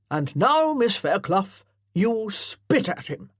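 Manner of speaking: loud parade-ground colonel; commanding colonel. Acted. Colonel directing his amateur actors, loudly commanding. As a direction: bark orders like a drill officer.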